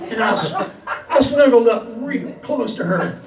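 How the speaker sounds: lively, lilting comic verse for a crowd; cowboy poetry recital. Acted. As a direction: tell it as a rhyming yarn from the stage. Recites comic cowboy poem to audience.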